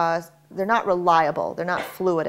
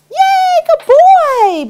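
much higher, louder gushing praise; happy tone; 12 dB louder, much slower than usual. Shown as a demonstration. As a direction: cheer for the dog like he just won a prize. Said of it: "Be sure you use a happy tone".

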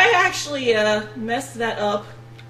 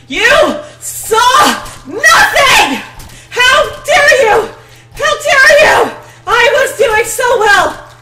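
much louder, higher hammed-up rage; furious screaming; 12 semitones higher, 13 dB louder, slower than usual. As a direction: scream at them like a crazed killer. Screaming at an in-game witness.

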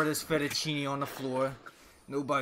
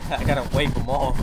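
much louder, matter-of-fact; 10 dB louder than usual. Real, not acted. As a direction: say it loudly over the noise. Loud complaint.

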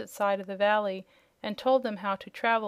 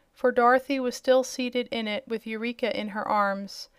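ordinary delivery, nothing marked

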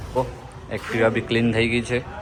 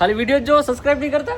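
playful questioning; 6 dB louder, much faster than usual. Real, not acted. Vlogger quizzing his little subscribers about not subscribing, playful high voice. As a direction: pitch it way up, loud and playful.

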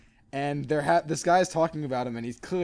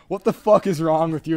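louder, indignant shout at the driver; angry yell; 8 dB louder than usual. Retold or imitated. Two strangers shouting at a driver who hit a kid, loud. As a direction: yell it at the car. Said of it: "And we're like".

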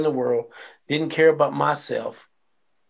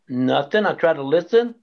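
ordinary talk, nothing marked

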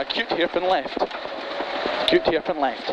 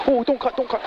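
urgent warning; 5 semitones higher, faster than usual. Real, not acted. Driver cutting the corner.

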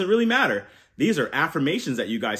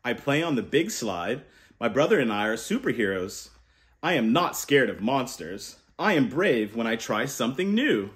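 gentle, lower storybook reading voice; reading to children; 5 semitones lower than usual. Acted. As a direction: read it like a bedtime picture book. Reading picture book aloud.

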